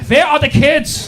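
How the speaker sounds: put-on slow, loud German-accented demand. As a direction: bark it like a German interrogator. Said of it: "needed at that point were German accents".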